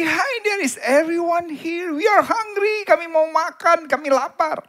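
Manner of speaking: put-on high, loud hungry boy's shout; imitated kid hollering for service; child yelling. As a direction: yell across an empty restaurant, pitched high. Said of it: "Dia teriak lagi".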